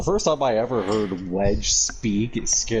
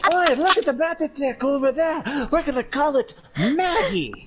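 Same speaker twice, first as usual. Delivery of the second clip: squeaky, much higher mock little guy; mocking Wedge. Mocking Wedge's excited chatter, squeaky and high. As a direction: chirp it like a hyper tiny engineer. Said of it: "he's all like".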